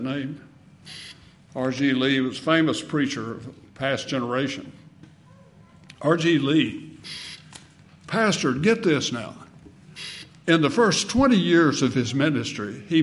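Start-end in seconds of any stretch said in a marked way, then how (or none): none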